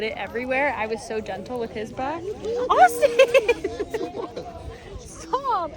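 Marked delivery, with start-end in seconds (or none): 2.7-3.2 much higher, loud cry of his name in protest; laughing shriek. Real, not acted. Husband smearing mud on her. 3.2-4.9 high squealing laughter; giggling fit. Real, not acted. Mud fight with husband. 5.0-5.7 high laughing stop; playful protest. Real, not acted. Husband smearing mud on her.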